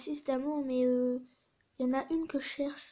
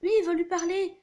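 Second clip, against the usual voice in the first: loud, higher acted insistence; urgent urging; 4 semitones higher, 8 dB louder than usual. Mother urging her son to go see the conductor, loud and insistent. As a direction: push it out loud and high.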